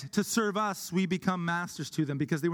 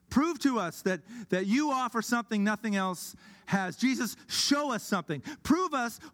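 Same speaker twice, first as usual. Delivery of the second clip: insistent, higher-pitched challenge; demanding crowd; 5 semitones higher than usual. Acted. Crowd around Jesus demanding proof, insistent challenge. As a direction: press hard for proof, pitch raised.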